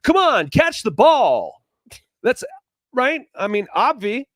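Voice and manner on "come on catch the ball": high, loud sideline coach yell; exasperated coach. Acted. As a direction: drag it out loudly like a fed-up coach. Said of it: "the worst thing that a coach could ever say".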